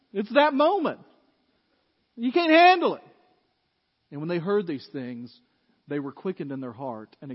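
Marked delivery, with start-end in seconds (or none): 2.2-3.0 higher and louder, hammering each word; shouted rebuke. Acted. Pastor as Stephen confronting the council, loud accusation. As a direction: throw it in their faces. Preacher repeats the climax.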